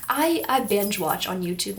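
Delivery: ordinary delivery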